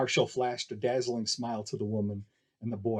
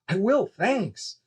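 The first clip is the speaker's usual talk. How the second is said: put-on little boy voice; 9 semitones higher, 3 dB louder, much slower than usual. Boy answers eagerly.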